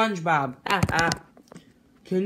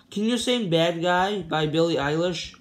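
ordinary talk, nothing marked

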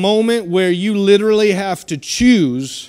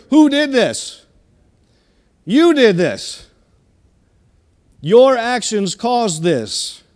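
stern parent; 4 semitones higher than usual. Retold or imitated. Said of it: "I'll ask them right before I spank".

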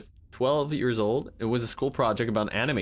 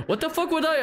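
surprised. Real, not acted. Shocked after dying.

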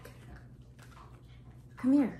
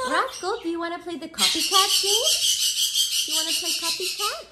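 high loud sing-song invitation; high coaxing; 9 semitones higher, 9 dB louder than usual. Retold or imitated. Owner inviting her parrot to the copycat game, high sing-song. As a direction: call the bird to play, high and bright. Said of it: "And I ask him".

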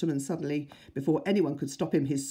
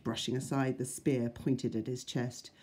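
plain reading voice

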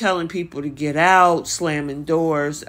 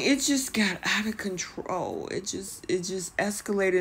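normal talking voice

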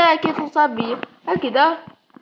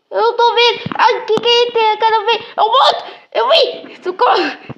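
squealing with scared laughter; 9 semitones higher than usual. Real, not acted. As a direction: shriek and laugh at the monster. Scared by monster in game. (gritos agudos de susto, rindo)

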